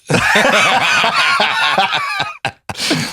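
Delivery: big high burst of laughter; real helpless laugh; loud high cackle. Real, not acted. Friend's Scouse voice cracks him up.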